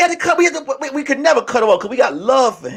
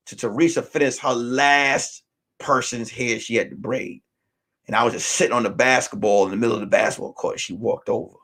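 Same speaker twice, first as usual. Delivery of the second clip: ordinary talk, nothing marked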